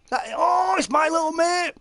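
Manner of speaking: sudden high excited shout of recognition. Real, not acted. Recognising an old friend online.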